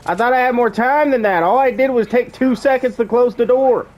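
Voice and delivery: high, startled, caught off guard; panicking gamer. Real, not acted. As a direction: gasp out as the storm hits. Storm catches him unexpectedly.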